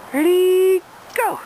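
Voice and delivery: loud, high ready before the throw; excited call. Real, not acted. Owner about to throw the toy, loud excited call. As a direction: rev the dog up.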